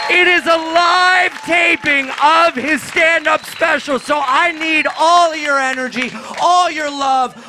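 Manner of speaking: loud hype host voice to pump the crowd; rally the room loudly for the special; announcer hype. Acted. Hyping crowd for taping.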